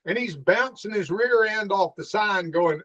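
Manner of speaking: ordinary delivery, nothing marked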